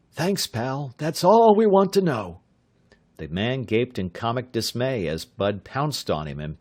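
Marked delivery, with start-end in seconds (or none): 0.1-2.4 higher and louder, triumphant; spring the trap with a gleeful shout; triumphant young man. A narrator's reading.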